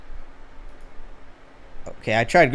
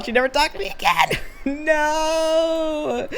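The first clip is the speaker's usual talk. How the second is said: very high, mock-wailing voice; hammed-up dismay; slower than usual. Streamer reacting to a chatter's awkward story, hammed-up dismay. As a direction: wail it high, drawing out the no.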